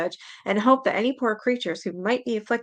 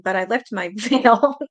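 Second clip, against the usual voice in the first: louder, slowed by laughter; real laugh breaking in; 5 dB louder, slower than usual. Real, not acted. Reader cracks up mid-story, laughing. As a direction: lose it laughing at the end of the line.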